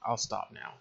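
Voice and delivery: ordinary talk, nothing marked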